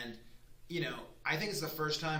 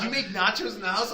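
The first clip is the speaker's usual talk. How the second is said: much louder, higher, laughing; mock the wife's astonishment, laughing; wife amazed about homemade nachos; laughing disbelief; 7 semitones higher, 11 dB louder than usual.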